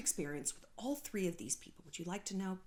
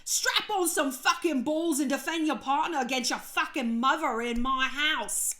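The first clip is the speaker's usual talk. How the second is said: retold scream; 4 semitones higher, 4 dB louder than usual. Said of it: "screamed at Gigi".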